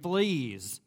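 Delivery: sudden yell of alarm; startled shout; screamed. Retold or imitated. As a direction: cry out as if just bitten. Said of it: "and she screamed".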